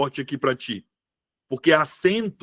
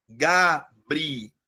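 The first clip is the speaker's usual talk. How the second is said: "Gabriela" very slowly, broken into Ga, bri, ela with pauses; "Gabriela" split for a class, piece by piece; syllable by syllable; 4 dB quieter, much slower than usual. Shown as a demonstration. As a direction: spell out "Gabriela" in separate chunks, lingering on "ela". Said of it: "é o E".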